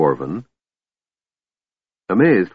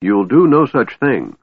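raised, loud scornful refusal; snorting; 5 semitones higher, 5 dB louder than usual. A narrator's reading. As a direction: forbid it with a scoff. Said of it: "the dwarf snorted".

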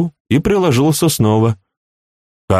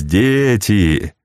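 amused scorn; 3 semitones higher, slower than usual. A narrator's reading. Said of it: "посмеиваясь".

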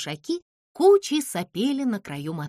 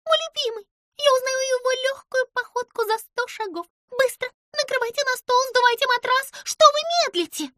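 high, rising loud hurry; excited Pimpochka; 14 semitones higher, 5 dB louder than usual. A narrator's reading. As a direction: rush everyone, thrilled. Pimpochka in a rush. (взволнованно, высоко и громко)

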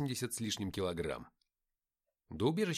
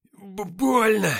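high, loud, drawn-out howl of pain; 8 semitones higher, 13 dB louder, much slower than usual. A narrator's reading. Nikita howls as his arm is twisted, pained wail. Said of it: "взвыл Никита".

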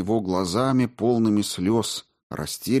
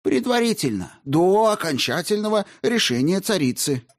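defensive clerk; 11 semitones higher than usual. Acted. Clerk's quick reply.

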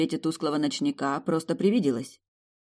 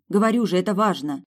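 raised, louder insistence; indignant; 4 semitones higher, 6 dB louder than usual. A narrator's reading. Heroine insists to the guard it matters, indignant. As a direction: protest that you mean it. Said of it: "недоуменно возмутилась я".

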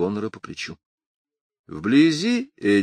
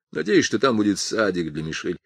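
ordinary delivery, nothing marked

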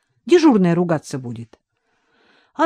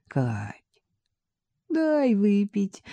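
slow, softer pleading "дай выпить"; plaintive; 4 semitones higher, 4 dB quieter, much slower than usual. A narrator's reading. Vadim begging Katya for a drink, plaintive. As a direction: whine for a drink like a sick man. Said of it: "уже жалобным тоном попросил Вадим".

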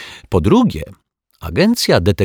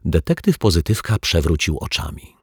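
ordinary delivery

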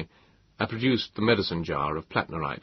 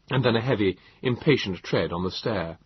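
ordinary delivery

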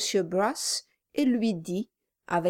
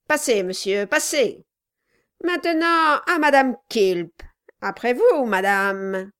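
mock polite dwarf; 3 semitones higher, 6 dB louder than usual.

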